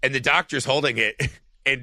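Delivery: normal talking voice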